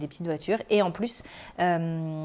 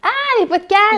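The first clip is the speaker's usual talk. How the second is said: high, loud sudden "aha"; excited realization; 14 semitones higher, 12 dB louder than usual. Real, not acted. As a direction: burst out as it comes back to you. Sudden remembering, live banter.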